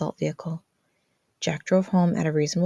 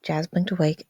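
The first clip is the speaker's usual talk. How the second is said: usual speaking voice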